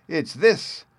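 high, gleeful shout of triumph; exultant cry. Acted. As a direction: crow it like a naughty boy's win. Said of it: "cried the bad boy exultingly".

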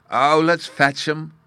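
put-on high, loud excited child's cry; a boy bursting with eagerness; eager cry. A narrator's reading. Fairy boy crying out eagerly to fetch violets. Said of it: "cried Bruno".

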